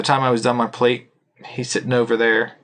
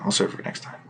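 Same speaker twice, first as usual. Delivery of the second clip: quiet aside; 8 dB quieter, faster than usual. Real, not acted. Trailing off, keeping story back.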